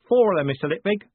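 high, loud coachman's report; announcing. A narrator's reading. Coachman reporting the guards to Moist, loud announcement. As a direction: announce it proudly over the coach. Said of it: "Jim announced".

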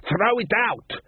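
high, slow yelled command; shouted order. Acted. Troll yelling at Buddy to dump the guitar, high and slow. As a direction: bark the order over the noise.

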